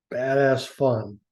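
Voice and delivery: ordinary delivery, nothing marked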